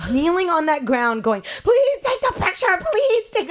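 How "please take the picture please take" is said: very high, loud acted plea; near-tears pleading, played big; desperate begging. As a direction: beg frantically, pitched way up. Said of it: "beg-, we're like, beg-, we're almost crying".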